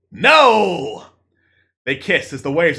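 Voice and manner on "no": greaser voice. Acted. Zuckerberg answers in play.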